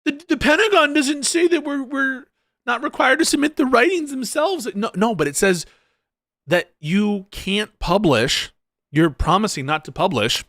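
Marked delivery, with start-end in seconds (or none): 0.1-7.8 heated protest. Real, not acted. Heated argument over the agreement.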